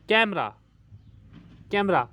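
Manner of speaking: normal talking voice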